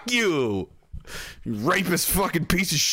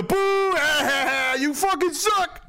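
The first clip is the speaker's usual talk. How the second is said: high jeering heckle; mock heckler jeer; 10 semitones higher, slower than usual. Drunk heckler jeering a performer, high sneer.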